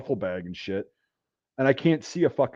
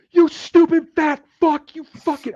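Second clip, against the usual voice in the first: high, loud, slow-stretched insult; enraged yelling; 7 dB louder, slower than usual. Retold or imitated. Woken soldiers glaring at alarm puller, enraged yelling. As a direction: imitate furious comrades yelling abuse. Woken men rage at him.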